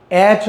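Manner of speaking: एच as "etch"; एच with no ay glide; mistaken pronunciation. Shown as a demonstration. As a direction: say एच with a short eh. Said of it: "नहीं होता".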